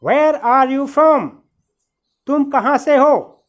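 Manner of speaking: ordinary delivery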